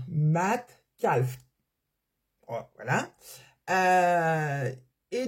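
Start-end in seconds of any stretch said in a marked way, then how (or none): 0.1-1.4 « Minecraft » étiré très lentement, syllabe par syllabe; traîne sur « Minecraft », comme pour bien l'articuler. Shown as a demonstration. (« Minecraft » prononcé au ralenti)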